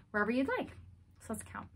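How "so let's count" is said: soft whispered cue. Shown as a demonstration. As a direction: hush them to count. Teacher whispers before counting.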